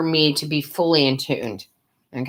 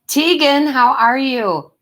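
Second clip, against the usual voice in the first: much higher and louder, drawn out; excited greeting; 10 semitones higher, 5 dB louder, slower than usual. Real, not acted. To a viewer named in chat, a loud high excited hello. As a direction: sing out a happy hello to a friend.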